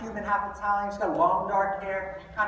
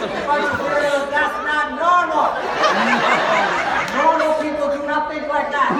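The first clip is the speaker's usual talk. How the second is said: emphatic; 7 semitones higher, 10 dB louder, slower than usual. Real, not acted. Emphatic punchline to audience.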